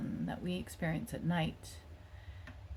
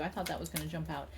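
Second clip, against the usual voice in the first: usual speaking voice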